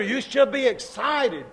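usual speaking voice

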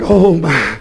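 a hint of a sighing, put-upon churchwoman; lightly mocked mournful sighing; mock moping woman. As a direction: sigh it out like a martyr in long dresses. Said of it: "You go moping around like".

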